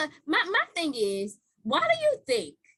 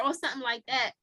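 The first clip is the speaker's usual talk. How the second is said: ordinary talk, nothing marked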